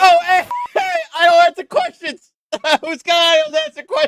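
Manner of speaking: high, giggly mock protest; laughing mimicry. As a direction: parrot the ranter while cracking up. Mimics the ranting man while laughing.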